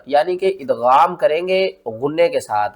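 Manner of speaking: ordinary talk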